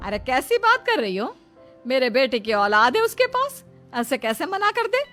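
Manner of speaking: high, forceful protest; indignant mother. Acted. Mother defending her son's supposed child, indignant. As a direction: shoot up in pitch with outraged questions.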